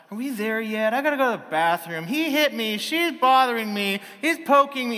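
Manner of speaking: high, loud mock complaints of squabbling children; whining kids. Little kids complain to parents on road trips, mock whining. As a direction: pile up grievances like kids in the back seat.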